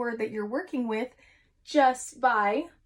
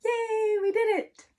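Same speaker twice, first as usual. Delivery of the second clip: cheerful yay; 12 semitones higher, 4 dB louder, slower than usual. Real, not acted. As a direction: celebrate with the class. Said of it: "celebrating with the children".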